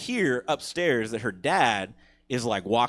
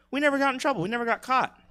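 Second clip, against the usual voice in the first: gleeful boast; 11 semitones higher, much faster than usual. Real, not acted. Bragging about not getting caught.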